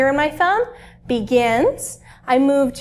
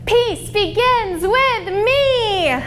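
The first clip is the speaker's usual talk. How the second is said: very slow, high, loud chant; as loud as you can; 7 semitones higher, 3 dB louder, much slower than usual. Shown as a demonstration. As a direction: shout the mantra slowly to the whole room. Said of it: "Say it as loud as you can".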